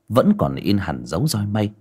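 ordinary delivery, nothing marked

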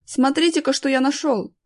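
high, eager call; acted excited shout from behind; calling out a find. A narrator's reading. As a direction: call them back to see a treasure. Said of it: "откликнул их".

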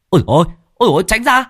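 high frantic yell to clear the way; panicked shout. Acted. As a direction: yell in panic, warning the boy to move. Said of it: "có người nào đó hét to". (tiếng hét hoảng hốt, giọng cao vút)

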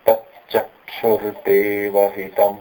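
तच्चक्षुर्देवहितम slowly, त and च weighted; stressed recitation. Shown as a demonstration. Teacher reciting a mantra word carefully. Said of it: "ये हमने बोला तच्चक्षुर".

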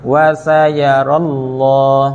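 "วะซายารัลลอฮ์" chanted, ending in a heavy rounded "lloh"; thick Allah. Shown as a demonstration. Teacher modelling recitation to students, thick chanted lam. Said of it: "คำว่าอัลลอฮ์นี่ให้หนา".

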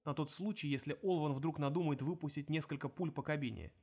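plain reading voice

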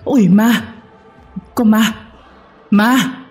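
high, panicked short screams; startled screaming. A narrator's reading. Husband waking from nightmare mistakes wife for ghost, startled screaming. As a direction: shriek in fright at a ghost. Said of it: "rồi hét lên".

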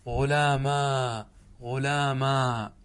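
غلاما stretched very slowly, long final aa; drawn-out demo. Shown as a demonstration. Teacher slowly modelling غلاما with final alif for class. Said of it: "اقلب الياء ألفا".

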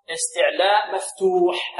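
slow استعلاء مفتوح with long vowels held; drawn-out rule. Shown as a demonstration. Teacher spells out the rule, drawn-out vowels. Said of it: "هكذا حرف استعلاء مفتوح".

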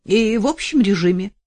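loud, self-satisfied lawyer; pompous lawyer. Acted. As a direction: lecture her smugly about the sentence. Lawyer explains sentence.

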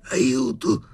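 very high, loud cry for help; panicked high-pitched yell; frightened shriek. A narrator's reading. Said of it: "strillò Sharikov impallidendo".

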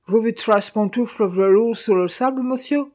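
high, loud pidgin French; loud servant voice climbing to the question; taunting Chinese servant. A narrator's reading. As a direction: press the taunt in a put-on Chinese accent.

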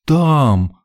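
high melodramatic cry; affronted lady's grand exclamation; drawn out with theatrical outrage; indignant. A narrator's reading. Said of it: "выражая всё негодование хозяйки".

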